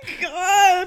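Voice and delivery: words through loud laughter; cracking up while talking; laughing. Real, not acted. Laughing hard at old tweet.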